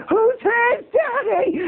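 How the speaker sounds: very high, drawn-out jeer; triumphant taunt. Retold or imitated. Boys jeer as their friend carries off the bully, high taunt. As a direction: throw the bully's line back, stretched out.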